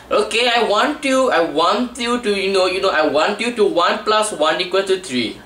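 mock Tamil English teacher giving instructions; Tamil-accented English, hammed-up classroom voice. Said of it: "there's a T-, Tamil one".

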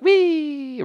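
high gleeful wee for the flying garlic skin; playful squeal. Pretend. Cook voices garlic skin flying off, high playful squeal. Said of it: "the skin just went".

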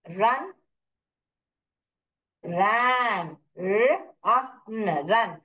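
"रन", "रैन" broken into r, a, n; phonics sounding-out. Shown as a demonstration. Teacher showing how to read by phonics, sounded out. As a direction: say "र, आ, न" as separate sounds, then blend. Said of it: "practice कीजिए फोनिक्स में".

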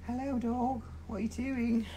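high, soft sing-song for the dog; pet voice. Real, not acted. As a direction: coo at the dog. Talking to the dog Yogi.

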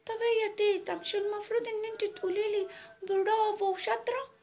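put-on high child voice fishing for praise; little girl. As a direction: ask grandma as a small girl, drawing out the end. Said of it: "قالت لها". (صوت طفلة مستنية المدح)

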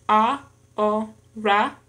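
slow "ahora" split as a, ho, ra; syllable by syllable. Shown as a demonstration. Learner showing three syllables in an exercise, "ahora" syllable by syllable. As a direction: say "ahora" in three separate beats. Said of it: "en tres sílabas".

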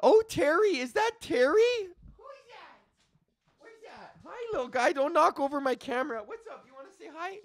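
high sing-song coo at the puppy. Real, not acted. Greeting the dog returning.

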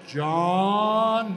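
a lightly voiced elderly owner calling his name; a hint of Art Rooney drawing out the name; Art Rooney's way of saying John. Owner Art Rooney phoning the rookie, a light imitation of his voice. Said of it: "that's how he talked".

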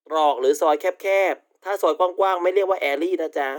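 ordinary delivery

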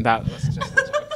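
laughing. Real, not acted. Laughing after the joke.

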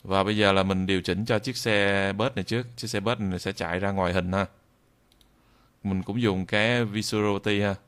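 normal talking voice

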